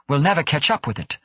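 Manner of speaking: high, fast, urgent cry; shouted protest. A narrator's reading. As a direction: blurt it out high and quick. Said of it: "O'Hara shouted".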